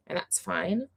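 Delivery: normal talking voice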